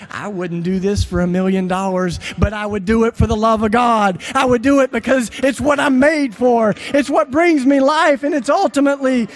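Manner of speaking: a preacher building to a climax; fervent preaching. Acted. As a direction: preach it to the back row. Preaching again at force.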